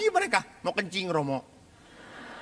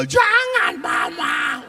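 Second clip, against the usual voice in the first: loud, shrieked plea, hammed up; mock child wailing; 6 dB louder, much slower than usual. Priest imitates children fearing mama's death, mock wailing. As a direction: bawl like a panicking child. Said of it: "Kejet-kejet mereka".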